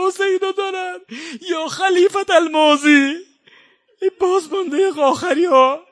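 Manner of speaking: high, loud, breaking into sobs; crying mourner's cry, rising and loud; sobbing lament. Acted. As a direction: wail it through tears.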